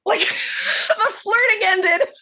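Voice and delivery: laughing hard, high and bright; can't stop laughing while telling it. Real, not acted. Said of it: "laughs at own story".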